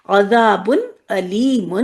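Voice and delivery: عذاب علیم slow, hamza in علیم; correct recitation. Shown as a demonstration. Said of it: "عین سے عادت ہوتی ہے". (درست حمزہ کے ساتھ)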